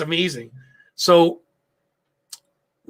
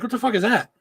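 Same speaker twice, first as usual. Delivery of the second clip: higher-pitched imitation of a baffled wife; mock bafflement; 7 semitones higher than usual. Wife hearing about ham dogs, mock baffled. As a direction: voice her disbelief, pitched up.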